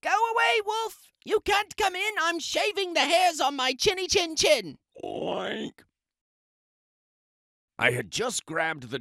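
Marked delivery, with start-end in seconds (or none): 0.0-4.8 much higher, piggy squeal voice; high squeaky pig yelling back at the wolf. Acted. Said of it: "He yelled back". 5.0-5.8 pig oink. Acted. Pig voice after quote.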